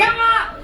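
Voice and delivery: very high short scream; hammed-up scalded shriek; mock scream. Client putting feet in hot water, mock scream.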